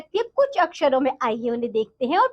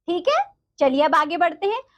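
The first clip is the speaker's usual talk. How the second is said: ordinary talk, nothing marked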